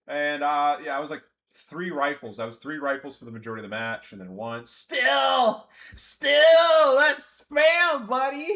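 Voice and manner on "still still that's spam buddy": loud, high sneering shout; mock whiny gamer. Streamer mimicking an opponent's whine, loud mockery. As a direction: ham up the sore loser.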